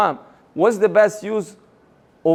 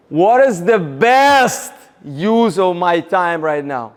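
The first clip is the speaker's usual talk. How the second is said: slow build onto a big, punched "best"; stress on best; 4 dB louder, slower than usual. Shown as a demonstration. Coach leads the group practicing emphasis, stress on best. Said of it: "we're gonna do emphasis on, uh, different words".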